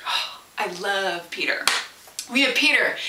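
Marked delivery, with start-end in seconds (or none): 0.1-1.6 soft whisper of affection; breathy fond whisper; whispered aside. Real, not acted. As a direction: hush it like a fond secret. Fond aside about Peter.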